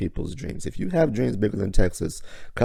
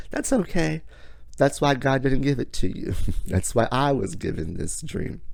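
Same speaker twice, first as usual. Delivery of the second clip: sweetly polite retort, pitched higher; mock polite comeback, higher; polite smile; 6 semitones higher than usual. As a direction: smile and say it nicely with a sting. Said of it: "you politely smile and say".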